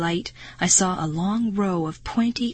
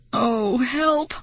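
moaning; 5 semitones higher than usual. A narrator's reading. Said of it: "I moaned".

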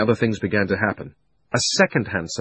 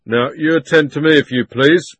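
firm policeman; 6 semitones higher, 8 dB louder than usual. A narrator's reading. Policeman demands attention.

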